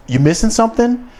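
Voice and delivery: high, mocking gotcha question; smug manager. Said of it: "He goes".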